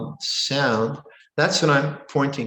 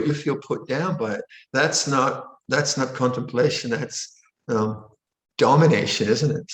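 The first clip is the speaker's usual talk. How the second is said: normal talking voice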